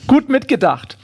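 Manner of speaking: lightly put-on polite kangaroo. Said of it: "sagt das Känguru".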